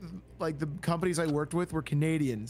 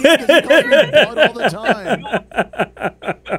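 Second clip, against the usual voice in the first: loud, helpless laugh through the words; 7 semitones higher, 15 dB louder, much slower than usual. Real, not acted. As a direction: crack up while talking, pitched high. Laughing at the bud joke.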